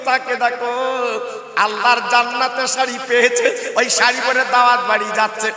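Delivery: loud chanted sermon with long drawn line endings; impassioned religious storyteller; full-force preaching. Acted. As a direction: preach it out, ringing and sing-song.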